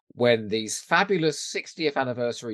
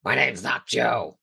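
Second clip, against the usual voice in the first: terrible puppet voice. Acted. Cohost greeted as Joe, answering in a lightly voiced puppet character. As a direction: try to sound like a bad wooden puppet. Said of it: "a puppet with a terrible voice".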